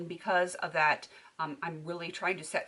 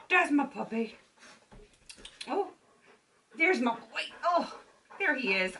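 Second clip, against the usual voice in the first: puppy talk; 6 semitones higher than usual. Real, not acted. Owner gushing over her puppy in high baby talk.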